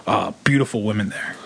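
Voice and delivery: put-on low, leering old man; lecherous grandpa. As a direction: drop low and leer it out. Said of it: "And he'd be like".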